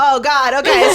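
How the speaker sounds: high, loud flustered gasp; overwhelmed gasp. Retold or imitated. Bride gasping at the sight of the groom, high and loud. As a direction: blurt it out, overwhelmed.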